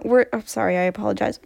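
ordinary delivery

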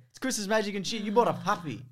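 high, loud comic protest; mock insistence. Mock-arguing for Christmas magic.

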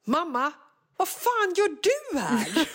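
high, slow, incredulous; a kid mortified to see his mom; shocked child. Retold or imitated. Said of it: "då säger han så här". (chockad tonåring, hög och utdragen)

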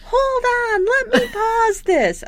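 high, slow mock yell; yelling dad. Deaf dad over a blasting TV, mock yelling. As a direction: holler it high and drawn out. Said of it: "so he's yelling".

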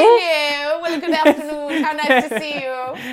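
plummy hostess greeting; gushing upper-class welcome; posh voice. Acted.

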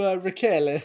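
put-on name said in Paolo's accent. Co-host playing Paolo naming Rachel, imitated accent. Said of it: "I love the way he says that".

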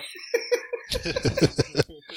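unvoiced rolling laugh; breathy laugh. Real, not acted. Laughing at Lineker's put-down. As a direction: chuckle at the memory.

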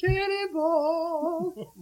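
long high sung-out yell; cannonball yell. Retold or imitated. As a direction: stretch it like jumping in a pool. Anchorman quote yell.

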